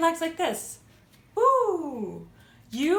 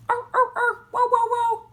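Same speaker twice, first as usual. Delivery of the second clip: high, yappy puppy barks; playful dog bark; 9 semitones higher, 5 dB louder, much faster than usual. Pretend. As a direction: bark like an excited little dog. Barking as a little dog.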